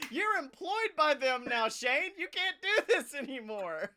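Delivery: high, loud mock reproach, half laughing; loud, high exasperated telling-off; incredulous scolding. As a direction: exclaim it in amused disbelief.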